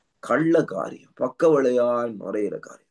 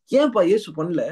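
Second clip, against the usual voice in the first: high, louder challenge falling at the end; challenging question; 4 semitones higher, 3 dB louder than usual. Real, not acted. Preacher asks congregation why Jesus didn't, challenging question. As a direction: throw the question out high and emphatic. Question put to crowd, then repeated.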